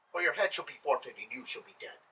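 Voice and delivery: low menacing threat. Acted. Sultan's threat drops low.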